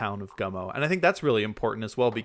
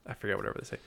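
normal talking voice

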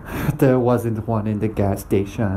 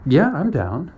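put-on girl's voice; 6 semitones higher than usual. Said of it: "And the girl says".

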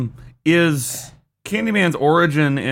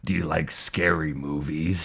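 low, soft creepy caller voice; hushed, deep slasher-film impersonation; Scream movie impression; 9 semitones lower, 8 dB quieter than usual. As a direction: drop deep and quote the horror villain. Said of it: "See, I could do much better".

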